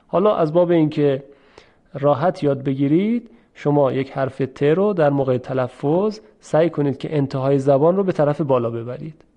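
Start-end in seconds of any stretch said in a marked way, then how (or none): none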